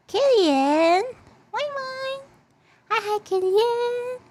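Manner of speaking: very high, drawn-out hello; high greeting. Real, not acted. Streamer welcoming a viewer who just arrived, high greeting.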